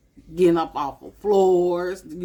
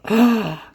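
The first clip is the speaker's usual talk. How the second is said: delighted gasp; 5 semitones higher, 7 dB louder than usual. Real, not acted. Flipping to the calendar page.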